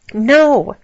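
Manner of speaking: protesting character's objection. A narrator's reading. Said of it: "Allie protested".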